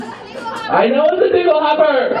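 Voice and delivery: high, drawn-out indignant cry; hammed-up high-pitched protest; mock outburst. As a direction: squeal it in exasperation. Snaps back about the dingle hopper.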